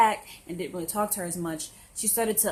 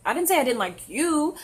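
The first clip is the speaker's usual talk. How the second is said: higher, louder retold objection with weight on YOU; exasperated protest; 7 semitones higher, 6 dB louder than usual. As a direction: protest as if wrongly accused. Retelling her protest to crying friend.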